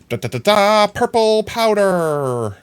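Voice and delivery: loud, high triumphant ta-da; mock fanfare. Streamer announcing purple powder to chat, loud mock fanfare. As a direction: belt it out, stretching the last word.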